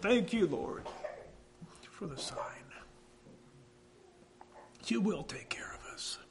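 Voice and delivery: breathy whispered thanks, high and soft; hushed prayer of thanks. Retold or imitated. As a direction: whisper a grateful prayer under your breath. Wife's awed prayer of thanks.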